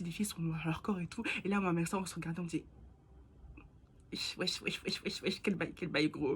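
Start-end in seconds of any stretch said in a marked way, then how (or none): 4.1-6.4 low, hushed retold disbelief; stunned muttering imitation. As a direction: imitate a dumbfounded aside under the breath. Retells stunned exchange with mother.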